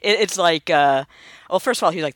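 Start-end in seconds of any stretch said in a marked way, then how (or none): none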